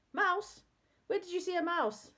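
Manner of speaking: high, urgent questioning; alarmed. A narrator's reading. Old man fearing for his mice, alarmed. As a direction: ask in alarm, pitched right up.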